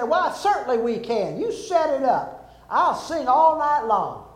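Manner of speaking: lady pleading to keep karaoke going; insistent lady. Retold or imitated. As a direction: push back, determined to sing. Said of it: "And she said".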